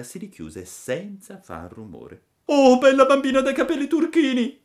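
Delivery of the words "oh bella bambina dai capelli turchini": high, very loud desperate call; pleading cry. A narrator's reading. Hunted puppet calls to girl, pleading shout. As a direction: call up to her, begging at full voice. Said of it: "gridava Pinocchio".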